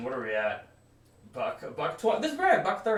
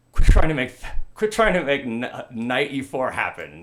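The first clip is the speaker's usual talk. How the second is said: loud, scolding cry that fades off; exasperated outburst; 11 dB louder than usual. Pretend. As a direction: shout it like a fed-up queen bee. Mean Girls style outburst.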